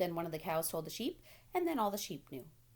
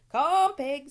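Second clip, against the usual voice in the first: farmer coaxing; 8 semitones higher, 13 dB louder than usual. A narrator's reading. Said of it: "said Mister Zuckerman".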